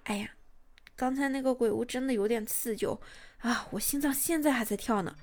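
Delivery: soft, cutesy self-talk; muttering to herself. Shown as a demonstration. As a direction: murmur it quietly to yourself, a bit shaken. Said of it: "小声的自言自语".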